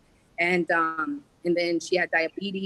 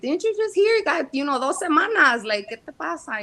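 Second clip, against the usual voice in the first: much higher, urgent disbelief; disbelieving retelling; 10 semitones higher, 4 dB louder than usual. Retold or imitated. Retelling her panic to her sick mother, high and urgent. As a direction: replay her own panic, pitched up. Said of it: "And I'm like".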